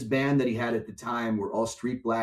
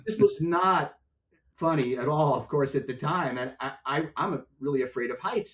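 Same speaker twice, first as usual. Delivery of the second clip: normal talking voice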